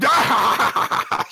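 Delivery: high, loud crazed yell; maniacal shout. Retold or imitated. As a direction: let out a wild manic cry. Kaiju laughing insanely.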